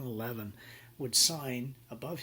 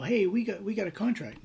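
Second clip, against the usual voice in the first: higher, louder mock outrage; indignant protest; 8 semitones higher, 7 dB louder than usual. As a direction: protest loudly that a deal was made. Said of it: "And you go".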